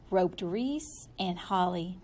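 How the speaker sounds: ordinary delivery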